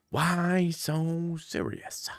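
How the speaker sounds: slow, drawn-out Joker menace, spoken soft; slow Joker line. Retold or imitated. Telling his friend the news in a slow Joker voice. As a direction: let each word hang like the Joker. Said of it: "And he said".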